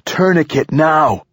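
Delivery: high, slow emphatic command; urgent shout. A narrator's reading. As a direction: shout each word so it carries over water. Urgent shouted order to wounded companion.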